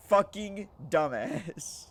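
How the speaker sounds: other bunkmate's curse. Retold or imitated. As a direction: drag it out, fed up. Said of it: "Guess what he says?".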